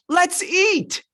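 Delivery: high, hammed-up excitement; gleeful cheer. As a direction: whoop it out as if it's all over. Israelites imagined celebrating freedom.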